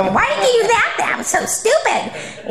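high, mocking scold with a laugh; mock high-pitched scolding. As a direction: squeak it out like a nagging wife. Mocking ex-husband's choices.

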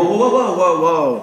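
high, rapid-fire protest; alarmed halt. Retold or imitated. Husband halting wife's honeymoon list, high alarmed whoas. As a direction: cut in to stop the rush.

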